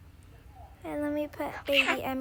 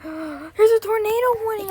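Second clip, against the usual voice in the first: gasping alarm; 4 semitones higher, 5 dB louder than usual. Pretend. Doll realises tornado is coming.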